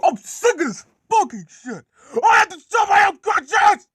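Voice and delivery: high yell building to a drawn-out scream; enraged rant. Acted. Gordon raging about doing his own coaches, enraged screaming. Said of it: "they were all really fucking mad".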